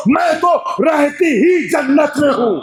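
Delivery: میں تو رہتی ہی جنت میں ہوں cried out high, stress on ہی; impassioned majlis delivery. Shown as a demonstration. Preacher voicing Fiza's reply to Salman, impassioned. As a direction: ring out ہی at full preaching pitch. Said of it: "میں نے مساعد کا ماحول بنا دیا تھا".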